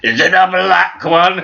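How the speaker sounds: high, loud mock Queen. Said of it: "the Queen".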